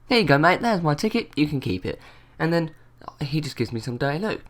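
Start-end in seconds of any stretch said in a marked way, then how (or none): 0.1-2.0 sarcastic, pitch jumping up; cheeky retort. Retold or imitated. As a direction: hand it over smugly. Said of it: "slapped that straight into his hands".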